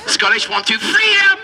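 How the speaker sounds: building to a hammed-up rallying cry; comic high-pitched war cry; mock battle cry. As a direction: count in, then high shout on freedom. Mimics a Scottish battle cry.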